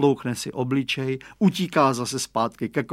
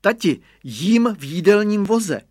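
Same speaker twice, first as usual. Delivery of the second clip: put-on higher, louder playful child's call; a boy calling to father from the cart, pitched up; shouting; 7 semitones higher, 5 dB louder than usual. A narrator's reading. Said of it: "křikl Radovan".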